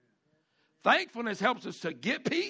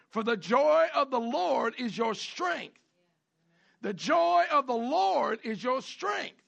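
loud, high, slow scripture proclamation; preaching at full force; 6 semitones higher, 5 dB louder than usual. Acted. Pastor proclaiming Nehemiah's command to the congregation, loud and slow. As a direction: proclaim it like a rally cry.

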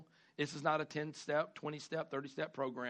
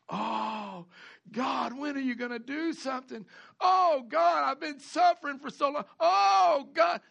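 loud, high, shouted lament; wailing prayer; 7 semitones higher, 7 dB louder than usual. Acted. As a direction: wail it like someone who's given up. Mocking desperate prayer.

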